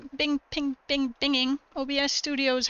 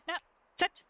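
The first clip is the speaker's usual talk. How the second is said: ordinary delivery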